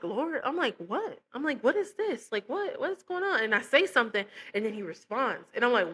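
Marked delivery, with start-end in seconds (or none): 0.0-3.4 high, puzzled asking; bewildered. Retold or imitated. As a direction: ask the Lord in high confusion.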